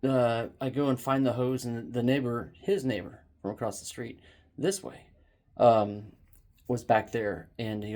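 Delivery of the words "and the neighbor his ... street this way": usual speaking voice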